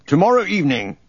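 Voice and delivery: high-pitched, lightly voiced governor; governor's announcement. A narrator's reading. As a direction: announce it high, falling at the end. Governor announces time after throat-clearing.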